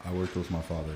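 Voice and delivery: speaking up. Real, not acted. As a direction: project it so they can hear. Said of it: "you can speak up".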